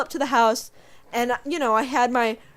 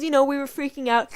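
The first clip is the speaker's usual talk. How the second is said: normal talking voice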